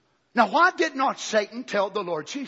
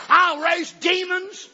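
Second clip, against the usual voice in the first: higher, louder, very slow vow; devil's threat peaking; 10 semitones higher, 8 dB louder, much slower than usual. Retold or imitated. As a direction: make "demons" the climax of the threat. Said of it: "he turns the threat on him".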